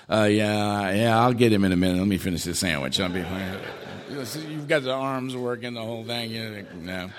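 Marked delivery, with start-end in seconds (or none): 0.1-3.6 low, offhand voice trailing down from a loud start; lazy call from someone in no hurry; unhurried nurse. Acted. Nurse putting off a call over a sandwich, offhand. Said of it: "When you hear from the nurse's station".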